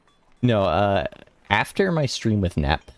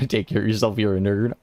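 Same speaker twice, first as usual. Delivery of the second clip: ordinary talk, nothing marked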